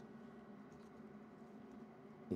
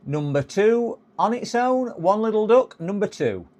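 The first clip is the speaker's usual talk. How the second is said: bingo caller; 8 semitones higher than usual. Pretend. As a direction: call the numbers like a bingo hall. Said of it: "bingo caller".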